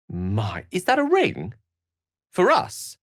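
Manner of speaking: surprised. Acted. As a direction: gasp with glee at the gift.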